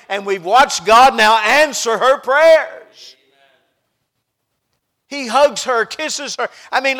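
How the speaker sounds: loud, high, rousing sermon voice; exultant preaching. Real, not acted. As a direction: proclaim it at full force to the congregation. Preaches the answered prayer.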